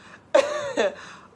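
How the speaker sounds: high burst of laughter. Real, not acted. Laughing about her kids' cute British accent. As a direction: laugh out at the joke.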